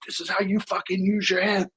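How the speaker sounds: high, raging coach bellow; coach yelling. Retold or imitated. Coach roars at his players at halftime, furious bellow. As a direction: roar it at the team like a furious coach.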